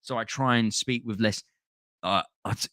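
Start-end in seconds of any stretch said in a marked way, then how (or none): none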